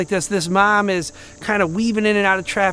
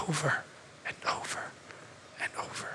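fading to a whisper; 4 semitones lower, 15 dB quieter, faster than usual. Acted. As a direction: drop from soft to a bare whisper. Preacher fades to dramatic whisper.

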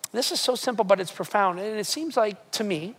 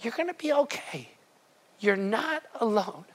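gentle voice; 5 dB quieter than usual. Retold or imitated. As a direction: breathe it like a quiet nudge. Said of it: "gentle voice".

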